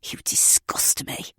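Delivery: whispered hiss. Acted. Ed crouched by her face, hissing in a whisper. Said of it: "he hissed".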